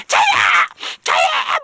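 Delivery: put-on very high shouting child; mock kid yelling; eager kid shout. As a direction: yell like a pushy kid wanting first pick. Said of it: "막 이래".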